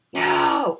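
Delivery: very low booming shout; deep dinosaur yell. A narrator's reading. Dinosaurs yell as they rush in, deep booming no. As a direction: roar it like a giant. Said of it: "dinosaurs yelling".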